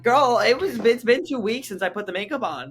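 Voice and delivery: high, loud sassy objection; loud, high incredulous retort; exasperated protest. Retold or imitated. Retold protest to the ex.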